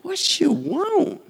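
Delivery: high, slow, drawn-out "What you want?"; smitten king. Shown as a demonstration. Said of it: "he said it like that".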